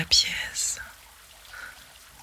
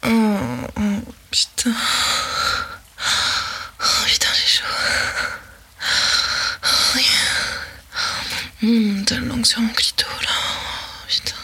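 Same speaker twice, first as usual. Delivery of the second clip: rising ecstasy; 3 semitones higher than usual. Acted. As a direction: let it climb until you cry out. Roleplay building to climax.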